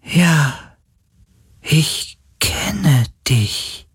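high, slow, breathy spirit voice; weak, dying being. A narrator's reading. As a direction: let it out faintly, like a dying creature. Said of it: "sie klang so schwach, so kläglich".

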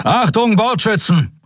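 high, urgent call to gunners. A narrator's reading. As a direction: call the crew to attention. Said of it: "rief er".

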